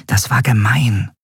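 usual speaking voice

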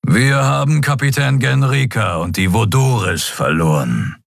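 serious alien captain giving a battle report; Skria captain. A narrator's reading. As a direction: report the losses soberly as Sron.

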